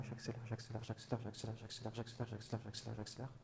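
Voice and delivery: soft, quick repeated j'accélère; speeding up. Acted. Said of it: "J'accélère le mouvement".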